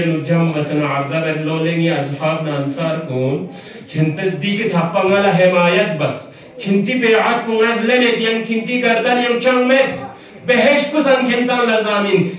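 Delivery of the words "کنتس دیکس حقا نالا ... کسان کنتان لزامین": high, ringing sermon delivery; impassioned preaching. Acted. Hussein addressing his companions on Ashura night, impassioned preaching. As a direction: preach it out in a raised, urgent pitch.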